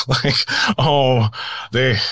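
chuckling while trying to go on; stifled amusement. Real, not acted. Guest catching himself laughing at his own abduction tale, amused. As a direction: let a laugh slip into the words. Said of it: "I shouldn't be laughing at it".

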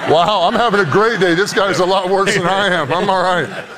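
laughing mock relief; mock cheered-up caller. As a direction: play a caller suddenly feeling better. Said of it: "could you imagine".

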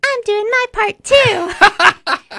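squeaky high take; high-pitched voice. Pretend.

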